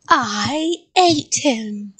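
very slow, loud monster voice; gloating creature. A narrator's reading. Creature taunting the child, slow gloating. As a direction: drag out the monstrous boast. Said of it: "the creature laughed".

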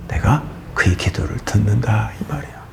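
hushed whisper of God's promise. Acted. Preacher to congregation, whispering God's promise. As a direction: drop to a secret whisper. Preacher voicing God softly.

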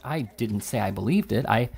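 usual speaking voice

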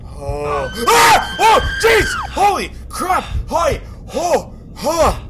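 loud high startled shouts; panicking gamer. Real, not acted. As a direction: shout in fright. Jump scare.

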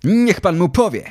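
put-on high, eager boy's urging; urging call. A narrator's reading. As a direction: egg him on from the sidelines. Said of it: "zawołał do taty Fabrycy".

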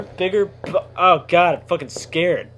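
normal talking voice

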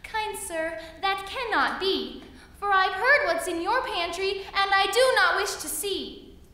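high, loud little fly voice; buzzy housefly. Acted. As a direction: squeak it high and urgent. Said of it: "said the little fly".

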